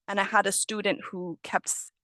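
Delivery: usual speaking voice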